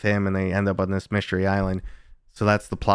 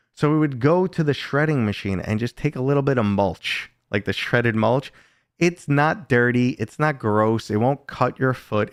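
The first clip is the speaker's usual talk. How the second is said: ordinary talk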